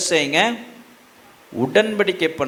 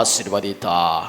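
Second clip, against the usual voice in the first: ordinary talk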